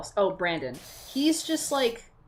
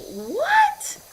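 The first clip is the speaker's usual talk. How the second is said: shrill disbelieving squeak of a what; high incredulous yelp; stunned, pitched way up; outraged what; 5 dB louder than usual. Real, not acted.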